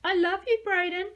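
high, loud sing-song mockery; mocking mimicry. A narrator's reading. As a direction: ape her cry to jeer. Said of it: "they mimicked".

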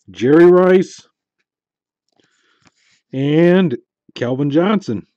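loud, high thrilled call of the names; thrilled shout. Real, not acted. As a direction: shout the big pull, thrilled. Big hit reveal.

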